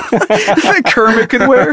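slow, high words through laughter; laughing. Real, not acted. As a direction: giggle it out. Laughing while joking.

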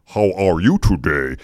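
ARE with a big, over-careful R; over-pronounce HOW ARE YOU TODAY like a diction student; exaggerated R diction. Student practising R words while walking around, over-articulated ARE. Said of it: "had to learn how to say our words".

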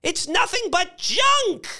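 very high, outraged exclamation; outraged old lady. A narrator's reading. Missus Bellows condemning the wreck, outraged.

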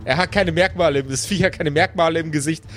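ordinary delivery

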